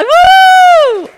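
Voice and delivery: very high, loud woo; excited cheer. Real, not acted. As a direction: whoop with delight. Cheering for country group.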